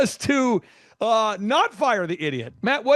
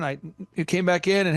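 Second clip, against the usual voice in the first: ordinary delivery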